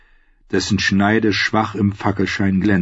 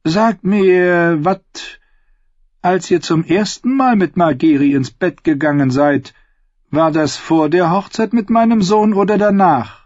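higher, pointed royal questioning; probing queen; 7 semitones higher than usual. A narrator's reading. Queen interrogates bard about Margeri, probing. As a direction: lay the trap for the singer.

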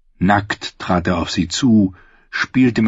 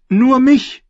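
put-on high, louder young girl; girl's voice; 5 dB louder than usual. A narrator's reading. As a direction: answer plainly as a young girl. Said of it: "die Stimme eines Mädchens".

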